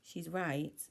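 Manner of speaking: plain reading voice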